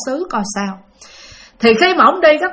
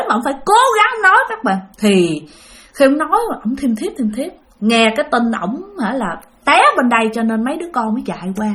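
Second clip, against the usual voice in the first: usual speaking voice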